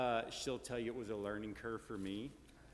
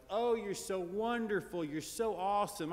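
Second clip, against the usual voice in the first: imitating a doting grandmother, pitched up; gushing grandma; 9 semitones higher, 4 dB louder than usual. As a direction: gush over him like a proud grandmother.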